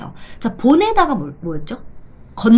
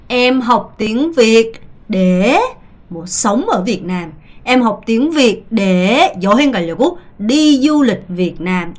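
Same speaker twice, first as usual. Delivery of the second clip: slow model answer; much slower than usual. Shown as a demonstration. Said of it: "대답해볼게요".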